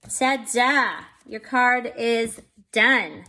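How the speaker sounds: big, drawn-out showing-off announcement; triumphant reveal. Real, not acted. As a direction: present it proudly, slow and loud. Presents the finished card.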